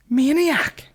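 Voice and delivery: very high, put-on squeal; cartoonish high-pitched outburst; mock exclamation. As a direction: squeal the word out in a silly high voice. Mocking cry about heckler.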